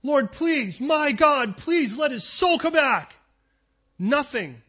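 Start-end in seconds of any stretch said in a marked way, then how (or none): 0.0-3.2 high, loud pleading; a prophet begging over the dead child; fervent begging prayer. Retold or imitated. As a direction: beg God desperately. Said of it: "begging prophet".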